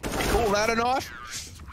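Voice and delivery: put-on slow, drawn-out Australian accent. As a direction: Crocodile Dundee style Aussie drawl. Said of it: "I even did it in my real Australian accent".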